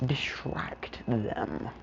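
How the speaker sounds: hushed whisper at the end. Acted. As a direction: drop to a whisper to keep it secret. Plan said under breath.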